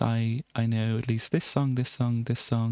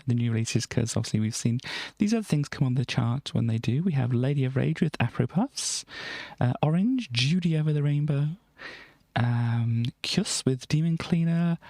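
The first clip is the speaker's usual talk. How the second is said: usual speaking voice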